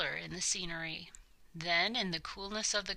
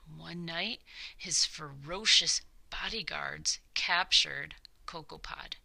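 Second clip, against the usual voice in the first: ordinary delivery, nothing marked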